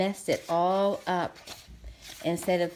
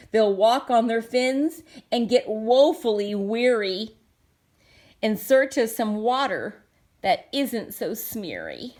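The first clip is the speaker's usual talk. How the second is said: higher, louder distressed lament; upset Lorax; 3 semitones higher, 6 dB louder than usual. Acted. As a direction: protest the pond's ruin. Lorax laments the polluted pond.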